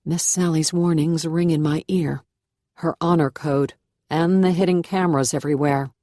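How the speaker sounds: usual speaking voice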